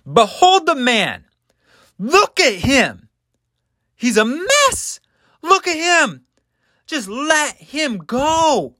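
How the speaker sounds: very high, strained appeal to a crowd; pleading Pilate. Acted. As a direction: beg the mob in separate, high-flung phrases. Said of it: "And, and Pilate says".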